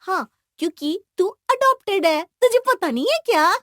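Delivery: high, loud sibling jibe; teasing taunt. Acted. Sister taunts brother about adoption, loud teasing.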